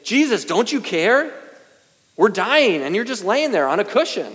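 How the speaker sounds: panicked disciples shouting over the storm; screaming at Jesus. Retold or imitated. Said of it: "No, they scream at him".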